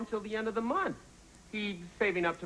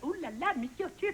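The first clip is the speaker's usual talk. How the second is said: mock French maid accent, higher; 10 semitones higher than usual. [name] jokes about Cindy as a French maid, in a hammy French accent. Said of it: "talk in a French accent".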